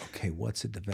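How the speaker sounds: hushed, half-whispered to himself; retold stunned whisper; whispered thought. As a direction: mutter the thought under the breath, baffled. Inner thought, stunned at counter.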